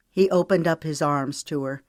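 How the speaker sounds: ordinary delivery, nothing marked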